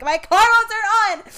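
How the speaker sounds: frantic high yell, slowed down; yelled in panic. Retold or imitated. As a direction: shout it at the honking cars. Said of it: "And I'm like".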